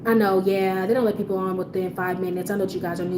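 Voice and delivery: low, soft customer-service voice; calm apologetic. Shown as a demonstration. Manager smoothing things over with a customer, calm and apologetic. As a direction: soothe the upset customer, keep it low.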